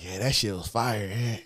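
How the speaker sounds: low, soft deadpan praise; hushed low-key approval; smooth, unexcited guy. Retold or imitated. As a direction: imitate him low and unbothered. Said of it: "That's what he sound like".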